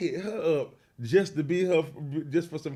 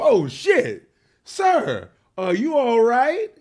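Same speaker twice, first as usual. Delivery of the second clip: higher, louder mock alarmed cop; startled police officer; 9 semitones higher, 8 dB louder, much slower than usual. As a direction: play the cop who sees the eye.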